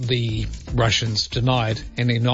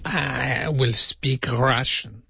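slow, drawn-out mock Russian; badly faked Russian accent; hammed-up Russian, stretching each word; much slower than usual. Shown as a demonstration. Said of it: "No, it still doesn't sound right".

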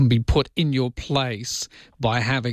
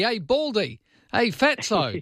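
higher, teasing call-outs; playful higher mock jeers; mock nickname calling; 8 semitones higher, slower than usual. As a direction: sing out the insults like a cheeky mate. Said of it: "when you call somebody".

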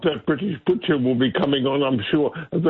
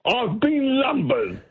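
slow, higher mock Cockney "I've been lumbered"; Cockney saying; 9 semitones higher, slower than usual. Caller quoting a Cockney line from the musical, light Cockney accent. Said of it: "amazing Cockney sayings".

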